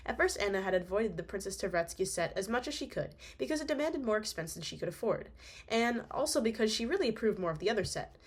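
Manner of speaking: usual speaking voice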